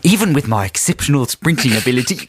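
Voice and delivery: put-on Aussie accent for a letter; comic Australian letter-reading. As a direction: read it out as a mock Australian. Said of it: "carry on in the correct accent".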